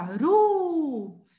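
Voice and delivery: "Ru" high, strong breathed R and long held U; teacher's emphatic, drawn-out "Ru"; strong R sound. Shown as a demonstration. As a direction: push the R in "Ru" and stretch the U. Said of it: "é um som muito forte".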